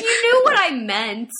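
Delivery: high, loud indignant comeback; retold protest. Speaker answering laughing children, high loud protest. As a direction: protest loudly at being laughed at. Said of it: "I was like".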